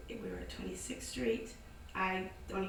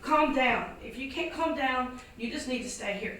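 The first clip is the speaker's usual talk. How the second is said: firm command; 4 semitones higher, 7 dB louder than usual. Retold or imitated. Ordering the panicking owner.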